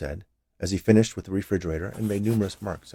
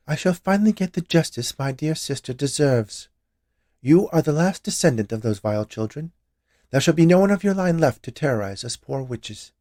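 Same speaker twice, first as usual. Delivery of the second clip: higher, louder menacing witch; vengeful witch; 7 semitones higher, 5 dB louder than usual. A narrator's reading. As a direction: pronounce the sentence on him.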